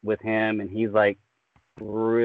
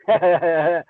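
villain audition cackle; 6 semitones higher, 5 dB louder than usual. Retold or imitated. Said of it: "I want that to be Lord Sion's voice".

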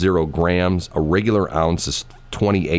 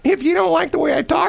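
Granny Shelley. Retold or imitated. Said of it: "And Shelley would say".

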